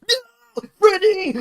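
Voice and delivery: high, loud cry of horror; hammed-up YouTuber shriek; mock gamer scream. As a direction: yell it in overdone panic. Mock gamer scream in Markiplier bit.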